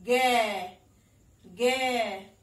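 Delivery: गै said loud and stretched, the vowel ai held clearly; teaching the sound. Shown as a demonstration. Teacher to child showing the ai sound, loud and clear. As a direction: call out गै slowly for a child to copy. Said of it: "तो इसे हम कैसे पढ़ेंगे?".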